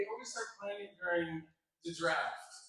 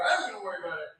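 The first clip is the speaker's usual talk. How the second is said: louder "I am gonna worry about it", leaning on "I"; insistent retort; 8 dB louder, much faster than usual. Retold or imitated. Actor repeating his answer to his co-star, insistent retort. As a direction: replay the stubborn comeback with force. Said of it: "I go".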